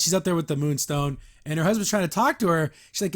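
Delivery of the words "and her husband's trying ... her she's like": normal talking voice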